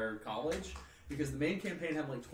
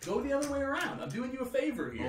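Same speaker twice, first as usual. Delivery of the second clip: high, breezy fake helpfulness; smug bluff; 11 semitones higher than usual. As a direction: sell the lie with a bright, too-friendly lilt. Bluffing the wizard in story.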